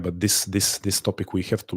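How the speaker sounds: ordinary delivery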